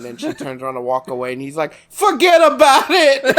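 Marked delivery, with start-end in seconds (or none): 1.9-3.2 high, loud hammed-up New York accent; mock New York brush-off. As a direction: shout it like a caricature New Yorker. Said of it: "We don't talk like that".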